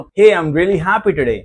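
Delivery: cheerful and lively; happy intonation. Shown as a demonstration. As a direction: sound truly happy about it. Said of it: "तो मेरी intonation सही है".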